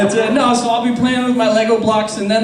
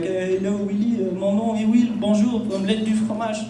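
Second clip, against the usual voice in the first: put-on French accent. Young Will introducing himself, light French accent. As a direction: lightly play a French schoolkid. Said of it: "I'd sound French as fuck".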